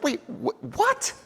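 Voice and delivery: mock bafflement. Mock confusion at governors.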